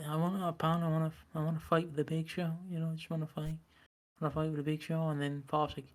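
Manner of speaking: soft, fast, pleading Sabu impression; quiet Sabu voice. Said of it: "Sabu's like".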